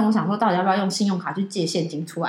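ordinary talk, nothing marked

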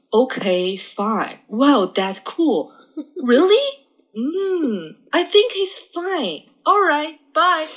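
slow, high, drawn-out stock English phrases; mock canned replies. Shown as a demonstration. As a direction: play a shy learner bluffing through. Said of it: "就是去外文". (慢吞吞、高音的敷衍英语套话)

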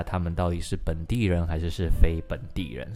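ordinary talk

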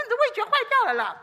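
高声笑着数落，语速快; laughing tease. Retold or imitated. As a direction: laugh it out while teasing him. Teasing husband about his taste. (笑着调侃丈夫)

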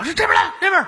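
拔高嗓门冲队友喊; 像打游戏时大声招呼; 学着喊叫. Retold or imitated. 游戏里连喊带叫. (高声喊"这边来")

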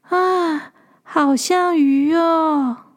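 put-on slow, wondering little girl voice; dreamy little girl. A narrator's reading. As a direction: murmur it slowly in wonder. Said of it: "心里面想".

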